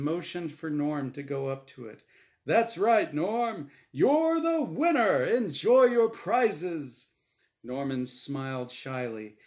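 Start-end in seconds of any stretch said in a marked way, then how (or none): 2.4-7.1 loud, high, slow emcee voice; game show host. Acted. Game show host Azrael congratulates Norm, drawn out. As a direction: stretch it out for the audience.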